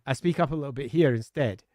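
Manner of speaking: usual speaking voice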